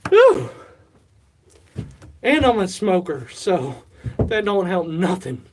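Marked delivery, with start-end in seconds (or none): 0.1-0.5 high, loud exhale of relief; real exhausted whew after exertion; winded whew. Real, not acted. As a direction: blow out a big tired breath. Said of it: "I'm out of breath".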